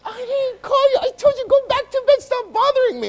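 squealing high, whiny protest; hammed-up falsetto annoyance; exasperated Eli. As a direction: whine it way up high, fed up. Acts Eli fed up, second waking.

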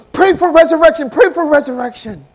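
high, loud pleading cry; desperate plea. Retold or imitated. Widow begging the brother to pray, loud and desperate. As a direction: beg him to pray, urgently.

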